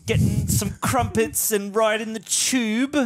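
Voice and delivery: mock London accent. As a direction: ask it as an overdone Londoner. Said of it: "you sound like you're from London".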